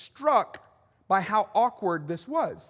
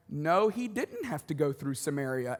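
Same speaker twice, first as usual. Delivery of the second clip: lower, softer know-it-all correction; mock scholar objection; 6 semitones lower, 6 dB quieter than usual. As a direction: play a scholar quietly objecting. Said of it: "a lot of you scholars are going".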